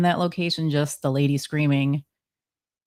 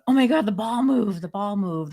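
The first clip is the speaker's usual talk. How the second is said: higher-pitched astonished exclamation; imitated amazement; 6 semitones higher than usual. Investigator replaying their reaction on the recording, amazed and higher. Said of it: "you could hear our gasp".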